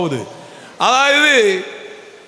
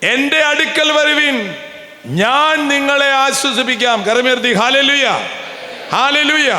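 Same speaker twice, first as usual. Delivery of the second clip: mock revival preacher; 5 semitones higher than usual. Priest mocking revival preachers to the congregation, hammed-up. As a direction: parody a fiery preacher calling people to come.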